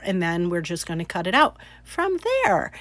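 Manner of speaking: normal talking voice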